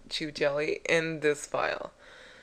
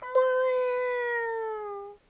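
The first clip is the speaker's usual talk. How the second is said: very high, long-held meow; cat impersonation. Retold or imitated. Player imitating what Link would go, high cat meow. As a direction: imitate a squeaky little cat cry. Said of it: "Link or Kevin just go".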